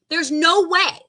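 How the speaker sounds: emphatic. Shown as a demonstration. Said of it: "repeat after me".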